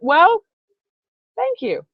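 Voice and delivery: acted, high-pitched coy reply; pause, then pitch the thanks way up; flattered thanks. Fan answering a top hat compliment, mock flattered.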